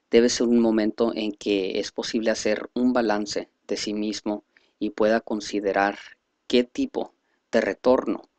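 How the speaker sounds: usual speaking voice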